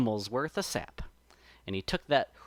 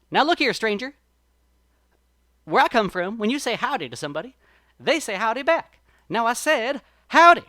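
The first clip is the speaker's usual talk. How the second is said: higher, louder rabbit scolding, ending in a shouted howdy; getting mad; 6 semitones higher, 7 dB louder than usual. A narrator's reading. Brer Rabbit scolds the silent sap person, growing angry. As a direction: build to a yelled howdy. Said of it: "he started to get real mad".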